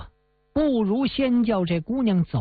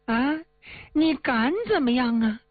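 arrogant villain; 2 semitones higher than usual. Acted. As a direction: brag and threaten like a spoiled bully. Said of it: "把这驴脸往下一耸了". (恶霸仗势欺人地嘲弄朱元璋)

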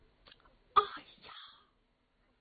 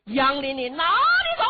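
much higher and louder, laughing challenge; loud taunting shout; 5 semitones higher, 8 dB louder than usual. A narrator's reading. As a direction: crow it after the fleeing king. Pursuers challenge Yang Lin.

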